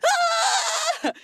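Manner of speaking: very high scream; shrill scream. Retold or imitated. Naomi seeing the dead snake, screams. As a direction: shriek in horror. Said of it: "she's like".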